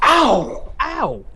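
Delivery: sharp cry of pain; pained yelp. Acted. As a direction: yelp at a sting. Stung by dart.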